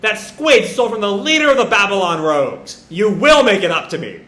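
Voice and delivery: indignant outcry, high at first, then easing into a demand; hammed-up outrage at being robbed; acted anger. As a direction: wail the loss, then lay down the law. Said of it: "Jet's anger".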